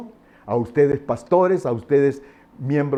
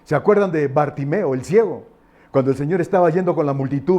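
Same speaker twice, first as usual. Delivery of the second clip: usual speaking voice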